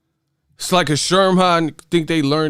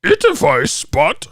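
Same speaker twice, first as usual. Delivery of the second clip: high, slow silly voice; exaggerated mimicry of a garbled message; mocking imitation; 14 semitones higher, slower than usual. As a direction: squeal the garbled name, drawn out. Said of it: "That motherfucker said".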